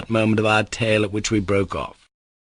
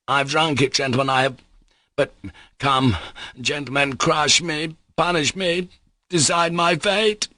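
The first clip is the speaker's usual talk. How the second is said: high, desperate plea to be judged; crying out; 6 semitones higher than usual. A narrator's reading. Mitya to the lawyer, crying out. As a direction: dare them to decide. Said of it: "cried Mitya".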